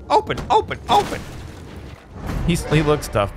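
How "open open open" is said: put-on very high, girly character voice; imagined girly voice. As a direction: squeal it out repeatedly in his imagined voice. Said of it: "I imagine that's how he talks".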